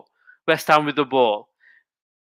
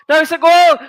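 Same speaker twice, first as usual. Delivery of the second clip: much higher, much louder cry of joy; goal shout; 13 semitones higher, 8 dB louder than usual. Real, not acted. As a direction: explode as the ball hits the net. Goal scored, commentator erupts.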